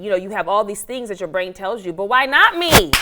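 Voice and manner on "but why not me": high, loud, defiant outcry; loud challenge. Real, not acted. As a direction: throw it out as a challenge. Challenging her negative self-talk.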